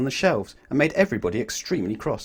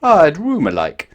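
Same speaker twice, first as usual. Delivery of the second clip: loud and slow, muddled words; Mister Topsy-Turvy; 5 semitones higher, 9 dB louder, slower than usual. A narrator's reading. Mister Topsy-Turvy asking for a room, loud and slow. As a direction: sound it out proudly and slowly. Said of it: "said Mister Topsy-Turvy".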